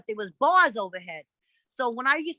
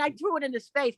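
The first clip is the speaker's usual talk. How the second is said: ordinary talk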